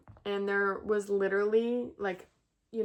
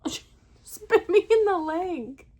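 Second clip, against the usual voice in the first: higher, louder shocked exclamation; startled laugh; 9 semitones higher, 8 dB louder than usual. Real, not acted. Just got bitten.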